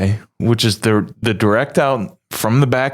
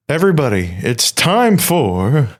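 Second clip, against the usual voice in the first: hammed-up announcer intro, last word stretched out and raised; game segment announcer; 6 semitones higher, slower than usual. Host introducing the podcast's game segment, hammed-up announcer. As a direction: draw out the final word like a game-show host.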